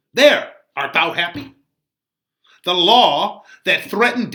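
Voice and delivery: put-on loud, high rebuke of a young man; impassioned friar. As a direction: scold Romeo like a fiery old friar. Reads Friar Laurence's speech aloud.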